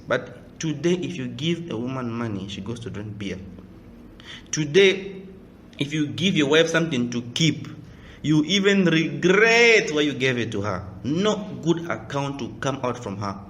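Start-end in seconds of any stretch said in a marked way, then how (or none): none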